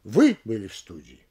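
ВЫ leaps up, the rest drops low; question on ВЫ. Shown as a demonstration. Lecturer demonstrating a partial question, stress on ВЫ. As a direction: punch the questioning rise on ВЫ only. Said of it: "вопросительную интонацию только в одном из них".

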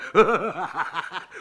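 helpless laughter; rolling belly laugh. A narrator's reading. Kondrat rolls on the ground laughing, helpless. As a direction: laugh flat on your back. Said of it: "покатываясь со смеху".

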